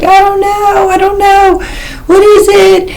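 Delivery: very high, squeaky, exasperated helpless voice; helpless whine. Retold or imitated. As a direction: mimic yourself as a lost little kid. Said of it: "And I'm like".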